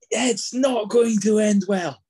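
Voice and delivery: loud, slow, high doom-laden call; slow it down and boom it out ominously; dramatic doom. Acted. Dramatic news doom line.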